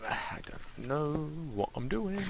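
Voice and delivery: soft unvoiced slow whisper. Real, not acted. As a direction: whisper it to yourself. Whispered aside.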